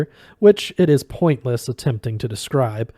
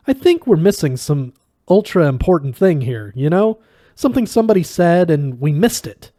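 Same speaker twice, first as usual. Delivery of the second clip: excited; 5 semitones higher, 5 dB louder than usual. Acted. Zaphod excitedly insists.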